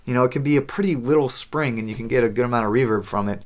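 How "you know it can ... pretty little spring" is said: usual speaking voice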